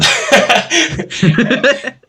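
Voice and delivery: a high burst of laughter; a sudden loud high laugh. Real, not acted. Laughs at wrong answer.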